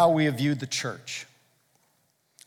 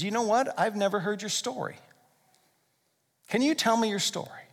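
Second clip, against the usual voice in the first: softer, sincere ask; gentle invitation; 3 semitones higher, 6 dB quieter than usual. Retold or imitated. Preacher voicing a deeper talk with a friend, softer and sincere. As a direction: ask quietly, as if it truly matters. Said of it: "what if we took it a layer deeper".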